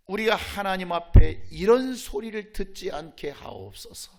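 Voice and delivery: ordinary delivery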